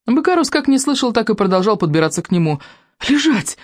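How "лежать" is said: high desperate shout; desperate cry. A narrator's reading. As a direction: cry out in despair. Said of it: "отчаянно крикнул Стоик".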